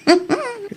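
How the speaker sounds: high excited giggle; shy giddy giggle. Acted. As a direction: giggle like a girl with a crush. Giggles naming Arnold.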